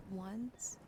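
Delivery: ordinary delivery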